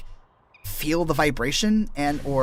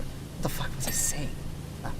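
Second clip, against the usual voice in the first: whispering; 10 dB quieter than usual. Real, not acted. Muttering to self.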